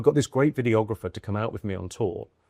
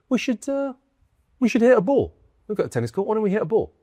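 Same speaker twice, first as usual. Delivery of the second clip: lightly voiced friendly invite, pitched higher; a hint of a cheery tennis star offering a game; friendly Andy Murray; 10 semitones higher than usual. Retelling a celebrity's kind invite, lightly voiced high and friendly. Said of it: "he very kindly came and he went".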